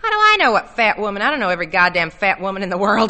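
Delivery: loud, exasperated comeback, without real laughter; brash, punchy protest; laughing retort. A narrator's reading. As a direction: toss it back loudly, scoffing. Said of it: "Arnold laughed".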